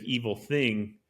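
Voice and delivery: ordinary delivery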